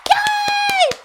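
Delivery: very high, loud drawn-out cheer; celebratory squeal. Real, not acted. Cheers at not being canceled.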